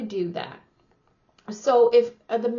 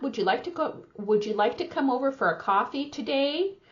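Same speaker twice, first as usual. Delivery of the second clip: higher-pitched invitation lifting at "today"; rising question intonation; 5 semitones higher, faster than usual. Shown as a demonstration. Coach repeats the example question, rising intonation on "today". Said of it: "Like that".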